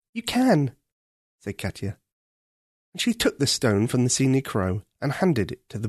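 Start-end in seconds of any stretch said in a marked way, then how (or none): 0.1-0.8 put-on higher, bright girl's answer; a girl with good news; Ketcha. Ketcha answering the prince, higher.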